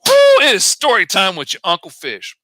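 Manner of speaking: loud, high whoop into an intro; excited announcement. Real, not acted. Host opening story time for viewers, excited announcement. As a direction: hype up the start of story time.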